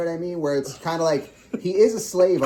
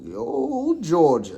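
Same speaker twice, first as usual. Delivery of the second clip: drawn-out mock Georgia drawl; Southern drawl; 3 semitones higher, much slower than usual. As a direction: try on a Georgia accent. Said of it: "How do they talk down in Georgia".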